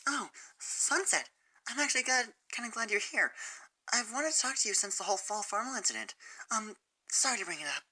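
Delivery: gentle and hesitant, almost apologizing; shy Fluttershy. Acted. Fluttershy voiced in story.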